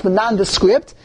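ordinary talk

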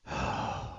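a long, soft, breathy sigh before conceding; resigned sigh. Retold or imitated. Mother giving in to her son, sighing in resignation. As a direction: let out a reluctant sigh of defeat.

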